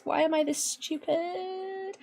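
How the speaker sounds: soft self-pitying whine. Real, not acted. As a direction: whimper it quietly in dismay. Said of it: "I'm gonna cry".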